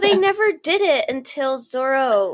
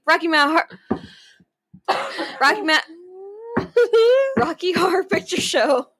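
retelling the slip while laughing; giggling through the title; 9 semitones higher than usual. Retold or imitated. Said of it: "I was like".

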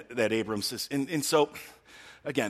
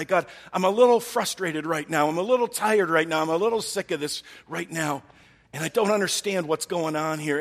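raised, exasperated complaint, higher and louder; frustrated Abram; 5 semitones higher, 4 dB louder than usual. Acted. Abram venting his frustration to God, higher and louder. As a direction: pour it out, fed up and pleading.

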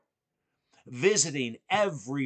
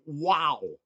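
retold scream; 5 semitones higher, 4 dB louder than usual. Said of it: "and I screamed".

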